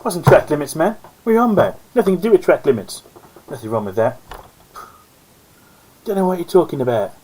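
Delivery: lightly voiced mock-indignant co-host; Duff impression. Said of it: "how can I do a Duff impression?".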